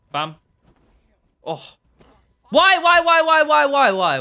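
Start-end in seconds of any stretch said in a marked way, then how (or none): 2.5-4.2 loud, high repeated why that fades out; frustrated yelling. Real, not acted. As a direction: scream it at the screen in disbelief. Getting killed in-game, yelling at it.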